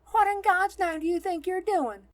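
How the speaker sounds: high, loud nun's scolding; outraged shout. A narrator's reading. Nun confronts a stranger near sick children, outraged shout. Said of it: "shouts a nun".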